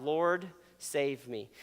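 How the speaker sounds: a mild, drawn-out call for rescue; understated cry for help. Acted. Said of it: "he cried out".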